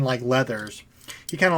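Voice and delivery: usual speaking voice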